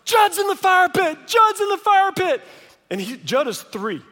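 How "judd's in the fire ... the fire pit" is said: put-on high, loud, frantic child's cry; panicked yell. Seven-year-old bursting through the door, yelling high and loud. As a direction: shout it as an alarmed boy bursting in. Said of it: "and he's like".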